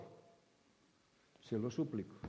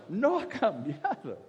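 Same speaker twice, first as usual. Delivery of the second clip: ordinary delivery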